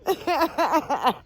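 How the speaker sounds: high burst of real laughter; helpless laughter. Real, not acted. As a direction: laugh off the scare in a high giggle. Laughing at own fright.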